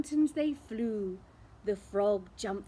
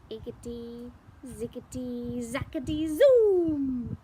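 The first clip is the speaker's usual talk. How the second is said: magic spell; 6 dB louder than usual. Acted. Witch casting a spell over the cauldron, building to loud. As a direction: start hushed and burst out on zoom. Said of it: "she muttered a spell".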